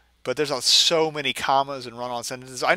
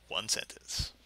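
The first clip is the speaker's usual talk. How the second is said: muttered dry jab; deadpan aside; 5 semitones lower, 11 dB quieter, slower than usual. Real, not acted. Reader mocks the run-on sentence, deadpan. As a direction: drop it flatly under your breath.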